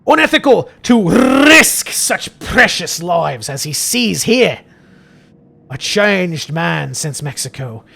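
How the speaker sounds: put-on Scottish accent with heavily rolled Rs; overdone Scottish priest, lingering on words; Father Jeremiah. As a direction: roll every R hard in grand put-on Scottish. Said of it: "More R rolls, the better".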